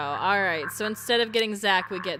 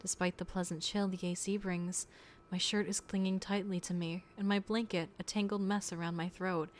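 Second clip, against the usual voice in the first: story narration; 6 dB quieter than usual. Acted. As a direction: read it like a quiet memory. Reads game story narration.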